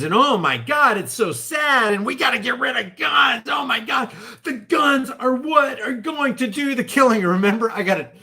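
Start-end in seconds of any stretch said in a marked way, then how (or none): 0.1-7.2 mock emotional protester. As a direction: ham up a weepy protester shouting. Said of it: "operating on this crest of emotions".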